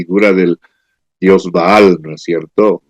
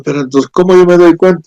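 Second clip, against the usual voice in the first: puzzled listener; 8 semitones higher, much faster than usual. Retold or imitated. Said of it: "tú estás pensando".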